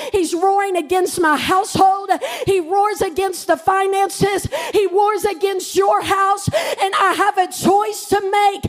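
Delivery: building sermon cry, climbing in pitch; full-force preaching. Real, not acted. Preacher defying the enemy's roar for her church, rousing declaration. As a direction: build from steady declaration to shouted proclamation.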